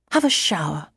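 usual speaking voice

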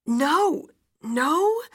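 high, loud, panicked denial; distraught. A narrator's reading. Wife refusing news of husband's death, distraught. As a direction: deny it desperately, pitch climbing.

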